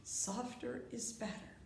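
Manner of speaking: very soft, low near-whisper; softly spoken teaching motto; hushed. Shown as a demonstration. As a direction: drop right down to a hush, as if showing how gentle to be. Models softness in a teaching phrase.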